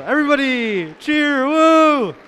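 rising to a loud cheer; hyping the crowd. Real, not acted. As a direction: call the room to cheer, building up.

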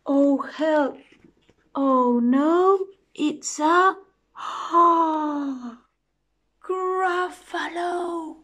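high, loud cries with a big gasp; alarmed mouse. Acted. Mouse suddenly meets the Gruffalo, high gasping fright. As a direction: build in fright to a stretched, high name.

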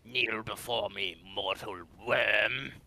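slow, dark demon lord voice; booming demon lord. Pretend. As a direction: loom over a mortal, slowly. Playful villain lines mid-game.

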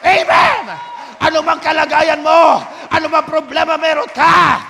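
loud, fervent preaching; preaching at full force. Real, not acted. Pastor urging the congregation about the oil, full-force preaching. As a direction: drive it home to the congregation.

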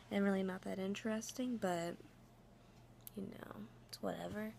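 hushed late-night whisper. Real, not acted. Late-night outro, kept hushed.

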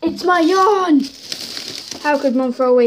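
loud, high cry of outrage; indignant outcry. Acted. Wailing over yarn Mum threw away, indignant outcry. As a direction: cry out in protest over a treasure.

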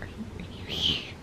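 breathless whisper, barely voiced. Whispering to the mom she thinks is a hallucination. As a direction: whisper it as if she might vanish. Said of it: "I was like".

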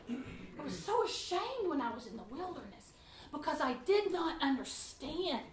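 ordinary talk, nothing marked

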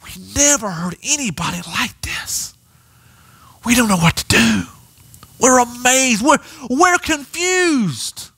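amazed, confused officers. Acted.

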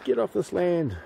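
high, slow mock telling-off; imagined angry landowner. Owner shouting from the window at a trespasser, mock yell. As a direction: play a cross owner shooing him away. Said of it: "is going".

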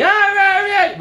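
high, loud "Ah, rah, rah" yell; mock yelling. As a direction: bark out rah rah like an empty tough guy. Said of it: "yelling, yelling".

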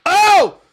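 loud, high-pitched yelp; startled scream. Real, not acted. Player hit by a jump scare, high scream. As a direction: scream out in sudden shock.

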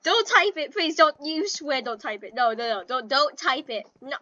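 ordinary delivery

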